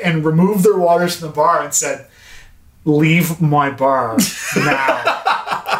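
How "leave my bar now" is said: slow drawn-out shout telling him to leave; slow mock-stern shout; shouted order. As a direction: bark it out slowly, kicking him out. Bartender throwing customer out.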